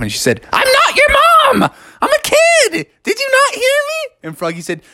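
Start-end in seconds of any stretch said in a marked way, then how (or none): 0.5-4.0 indignant squawk, very high; crazy old-person voice. A narrator's reading. Girl frog denies being Froggy's mom, crazy old-person voice. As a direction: protest in a silly screechy high voice. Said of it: "you still talk in that s-, crazy voice".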